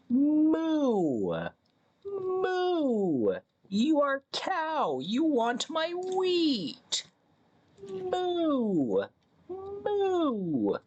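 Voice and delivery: very high, slow, drawn-out moo voice; cow roleplay. Pretend. Streamer playing a cow to lure a Minecraft cow, very high moo voice. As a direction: play a cooing cow luring the player. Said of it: "I'm playing the role of a cow".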